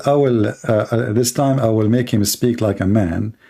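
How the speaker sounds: ordinary delivery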